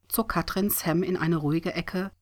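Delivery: usual speaking voice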